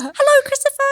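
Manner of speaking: very high, singsong mom greeting; high motherly call; imitating mom. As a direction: trill hello in a put-on mom voice. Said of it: "that's what my mom goes".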